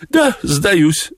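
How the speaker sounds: very high cat voice, falling at the end; high, squeaky talking cat; cat character. A narrator's reading. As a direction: concede in a high-pitched cat voice. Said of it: "сказал кот".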